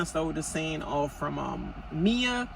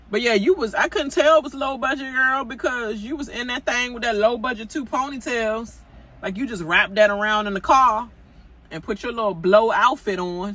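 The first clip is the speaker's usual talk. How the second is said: louder, higher, teasing call-out; loud sassy roast, pitched up; worked-up mockery; 7 semitones higher, 7 dB louder than usual. Real, not acted. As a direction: roast her outfit with gusto. Mocking cast member's outfit.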